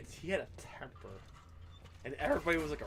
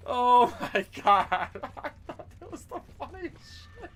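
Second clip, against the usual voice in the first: usual speaking voice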